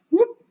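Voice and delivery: high swooping whoop; sound effect. Pretend. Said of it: "Sound effects and all".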